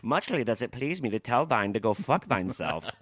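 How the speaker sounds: slow, put-on olde-worlde voice; mock archaic insult. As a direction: deliver the curse like a pompous courtier. Topping the archaic voice game.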